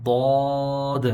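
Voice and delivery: ضاد with a heavy d and long rounded vowel; slow, full-mouthed ضاد; thick, heavy ضاد. Shown as a demonstration. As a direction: fill the mouth and stretch ضاد. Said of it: "یہ موٹا ہے".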